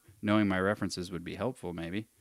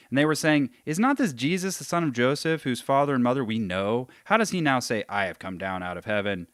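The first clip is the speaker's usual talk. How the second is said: higher, louder reading aloud; emphatic scripture reading; 4 semitones higher, 6 dB louder than usual. Acted. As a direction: read the crowd's challenge out emphatically.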